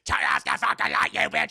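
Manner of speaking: loud, rough demonic growl; harsh, monstrous command; possessed voice. Retold or imitated. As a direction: roar it like a demon. Possessed Janet snaps at sister.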